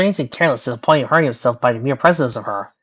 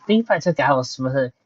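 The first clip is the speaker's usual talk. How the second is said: ordinary delivery, nothing marked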